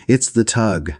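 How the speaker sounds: a light shriek of joy at the sighted tug. A narrator's reading. Hostess to her guests, mildly shrieking with excitement.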